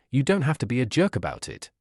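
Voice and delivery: ordinary delivery, nothing marked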